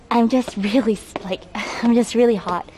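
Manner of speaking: panting from the stairs; winded, words come short; out of breath. Real, not acted. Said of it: "And I'm out of breath".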